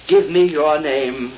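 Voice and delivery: ordinary talk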